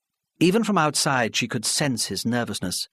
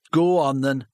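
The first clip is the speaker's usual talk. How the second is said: sulky; 4 semitones higher, 5 dB louder than usual. Acted. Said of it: "Charlie said sulkily".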